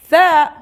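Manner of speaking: Tha with tongue between teeth, loud; teacher drilling Tha; letter name Tha. Shown as a demonstration. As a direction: model Tha as in thank. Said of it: "Try to pronounce tha".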